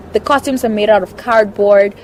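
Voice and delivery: usual speaking voice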